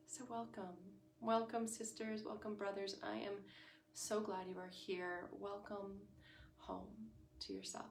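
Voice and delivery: soft, gentle meditation greeting; meditation welcome. Real, not acted. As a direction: welcome them softly into the practice. Opens the guided meditation with welcome.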